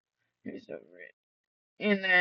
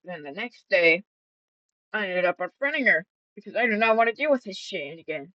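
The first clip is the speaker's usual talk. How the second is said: normal talking voice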